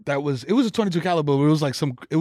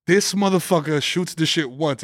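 ordinary delivery

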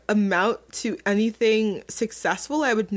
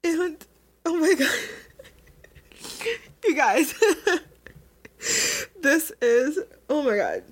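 higher, laughing through held-back tears; fighting off tears with a laugh; tearful laugh; 7 semitones higher than usual. Real, not acted. To viewers, overcome recalling feeling a failure, teary. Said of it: "getting so emotional".

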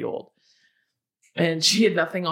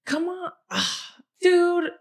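exasperated; 10 semitones higher, slower than usual. Retold or imitated. Imitating exasperated guard with sigh.

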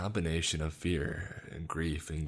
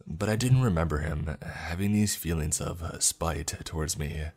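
ordinary delivery, nothing marked

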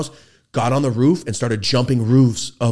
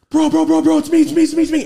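panicked pleading; 9 dB louder, faster than usual. Retold or imitated. Friend pleads at the door.

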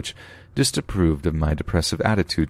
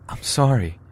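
tearful; 8 semitones higher than usual. Acted. Winnie crying.

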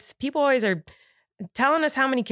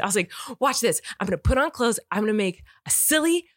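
normal talking voice

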